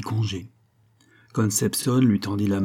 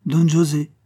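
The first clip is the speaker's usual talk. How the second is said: sharp, higher and louder cut-in; curt interruption; 6 semitones higher, 5 dB louder than usual. Acted. Concepción cuts off Don José, sharp and loud. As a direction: snap his name to stop him. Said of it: "interrompit sèchement".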